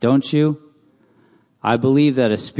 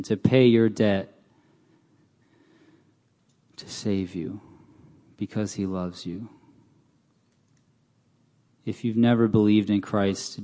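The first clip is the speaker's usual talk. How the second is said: softer, gentle invitation to receive Christ; altar call; 2 semitones lower, 7 dB quieter than usual. Acted. Preacher invites listeners to receive Christ, soft and pleading. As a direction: speak gently, inviting the lost forward.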